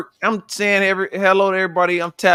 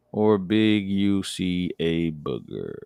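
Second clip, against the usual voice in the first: slow, low, deadpan misreading of the text; slow mocking reread; 8 semitones lower, 7 dB quieter, much slower than usual. Friend mocking a misspelled text, slow and low. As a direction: drag out each word of the garbled line. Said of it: "Are you talking about boogers or are you saying".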